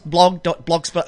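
ordinary talk

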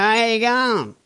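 slurred ocker "How you going?"; yobbo slang. Shown as a demonstration.

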